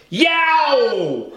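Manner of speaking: sharp high yelp of pain. Acted. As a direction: yelp like a bitten dog. Dog bitten on the neck.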